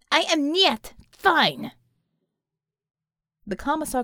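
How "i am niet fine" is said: high, loud, emphatic and slow; agitated outburst. A narrator's reading. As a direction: force out each word, pitched up. Agitated commissar blurts a denial.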